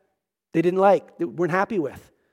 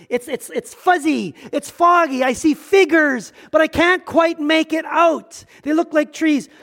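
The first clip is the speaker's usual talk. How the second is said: man straining to see; 6 semitones higher than usual. Acted. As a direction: strain to make out blurry shapes. Acts man unable to see clearly.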